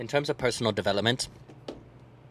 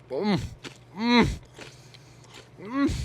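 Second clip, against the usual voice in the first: hums climbing higher with pleasure; rising mm of delight; 12 semitones higher, 3 dB louder, slower than usual. Real, not acted. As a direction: hum higher each time like it's delicious. Tasting food, rising hums.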